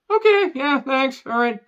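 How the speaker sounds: high-pitched awkward brush-off; put-on high little voice; mock polite exit. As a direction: chirp a hasty fake thanks on the way out. Acts out Rachel casually leaving psychic.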